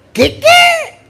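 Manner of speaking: shocked. Retold or imitated. Priest retelling his shock at the woman's news, high. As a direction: act out your own astonishment, in a high squeak.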